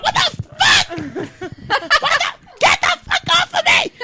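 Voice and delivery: high, yelling startled man; startled husband. Retold or imitated. Husband woken by the dog, high startled yell. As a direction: jolt awake and shove the dog off.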